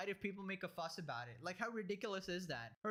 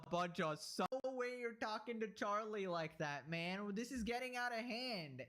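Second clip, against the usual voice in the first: usual speaking voice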